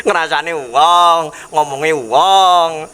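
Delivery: drawn out. Acted. Stressing gossiping.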